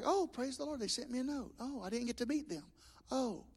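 quietly delighted at getting a note; soft delighted aside. Retold or imitated. Pastor retelling his joy at a note in the offering, hushed and pleased. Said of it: "So I'm like".

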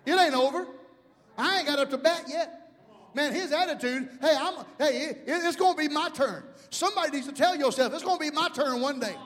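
high, loud exhortation; rally them with defiant hope; rousing preaching. Acted. Preacher urging people to expect their turn, rousing. Preacher builds up the boy's attitude.

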